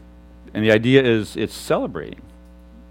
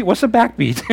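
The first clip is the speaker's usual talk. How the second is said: baffled; 8 semitones higher than usual. Retold or imitated. Acting out his baffled younger self.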